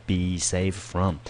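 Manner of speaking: be safe from, slow and deliberate; slow careful phrase. Shown as a demonstration. Teacher drills the phrase for students, slow and clear. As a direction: spell out be safe from word by word. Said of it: "一定要提醒同学一定要记起来".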